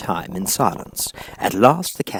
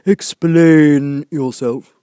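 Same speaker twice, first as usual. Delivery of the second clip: loud, very slow, drawn-out command; stern caterpillar; 7 semitones higher, 11 dB louder, much slower than usual. A narrator's reading. Caterpillar orders Alice to explain, loud and slow. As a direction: demand it slowly and heavily. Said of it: "said the caterpillar sternly".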